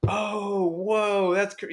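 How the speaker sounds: high-pitched mock amazement; amazed. West Coast racers learning he is Southern, amazed. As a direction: imitate an awed stranger, voice held high.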